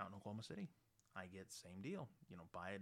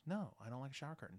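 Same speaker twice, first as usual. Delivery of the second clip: put-on teen daughter voice; 6 semitones higher, 6 dB louder, faster than usual. Teen daughter explains herself.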